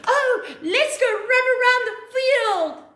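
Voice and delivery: high, bright sing-song teacher call with a laugh; cheery teacher voice. Retold or imitated. Teacher calling the class out to run, high cheery voice. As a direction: play the jolly PE teacher calling the kids out.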